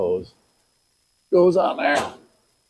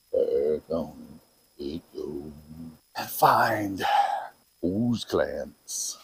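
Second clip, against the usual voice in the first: very low, soft, slow put-on voice; playfully low, drawn-out delivery; deep voice; 10 semitones lower, 7 dB quieter, slower than usual. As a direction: drop it deep and stretch it out. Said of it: "Gotta use your deep voice".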